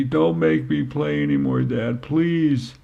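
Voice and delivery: lightly voiced young girl begging Dad; pleading child. Daughter begs dad on the sidelines, pleading.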